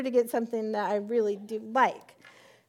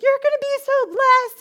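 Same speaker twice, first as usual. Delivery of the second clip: much higher, louder bubbly cheer; gushing encouragement; 14 semitones higher, 11 dB louder than usual. Retold or imitated. Friend Sherilyn encouraging the fasting woman, gushing. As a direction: cheer her on with delight.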